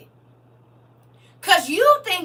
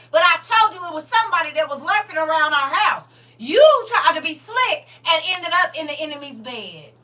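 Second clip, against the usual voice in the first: angry husband; 2 semitones higher than usual. Acted.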